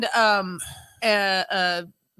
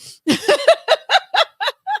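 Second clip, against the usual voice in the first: high burst of laughter; 4 dB louder than usual. Real, not acted. Reads a funny comment.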